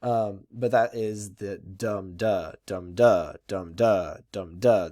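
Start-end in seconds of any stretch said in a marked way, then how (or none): 1.6-4.9 four steady "dum duh" beats, each "dum" stressed; trochaic stress. Shown as a demonstration. Teacher shows the rhythm you feel, trochaic stress. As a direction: beat out "dum duh", weight on the first syllable. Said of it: "that you feel when you're speaking that rhythm".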